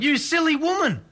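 exasperated, but without raising the volume; mild mock yell. A narrator's reading. Husband scolding wife over his new boots, mildly yelled. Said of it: "Pop yelled".

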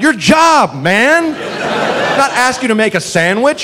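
high-pitched exasperated protest; mock indignation. Bartender to a cop who asks what he wants, mock indignation. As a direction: plead the obvious, hammed-up disbelief.

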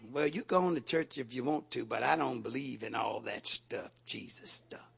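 brushing it off, trailing away softly; dismissive unbeliever. Acted. Preacher mimics an unbeliever waving off church, dismissively. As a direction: imitate a doubter, low and fading.

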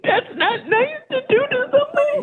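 high, tearful scolding; hurt, sobbing high voice; crying. Real, not acted. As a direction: reproach them while really crying. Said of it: "You're making me cry".

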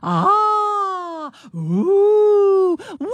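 high wailing ghost moans. Pretend. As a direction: wail it out like a haunted spirit. Reads out AI ghost noises.

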